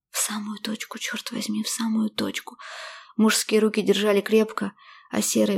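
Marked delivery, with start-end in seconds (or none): 0.1-2.6 breathy inner confession; whispered admission. A narrator's reading. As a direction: whisper it like a secret. Heroine admits it to herself.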